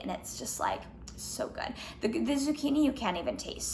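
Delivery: ordinary delivery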